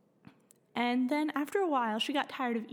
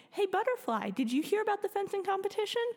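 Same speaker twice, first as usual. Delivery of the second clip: lightly voiced excited goat, higher and quicker; 10 semitones higher, faster than usual. Goat Sparky hailing a passing butterfly, high and eager.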